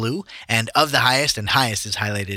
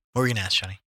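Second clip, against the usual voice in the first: normal talking voice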